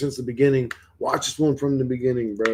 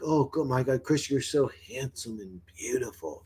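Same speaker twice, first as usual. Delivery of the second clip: softer, doting mock adoration; infatuated voices; 9 dB quieter than usual. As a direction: coo it like an admirer, half-joking. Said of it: "why don't my voices got me, like, infatuated with me?".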